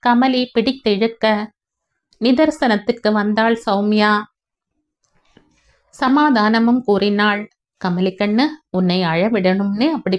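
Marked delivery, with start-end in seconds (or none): none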